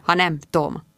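plain reading voice

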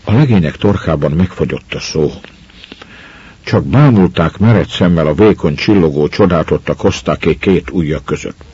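usual speaking voice

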